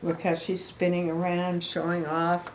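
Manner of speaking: usual speaking voice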